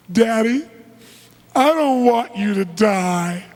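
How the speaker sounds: put-on high, loud, drawn-out child's plea; crying little daughter. Five-year-old begs her smoking father, mock tearful wail. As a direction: wail it like a distraught little girl, acted. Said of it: "she's crying hysterically".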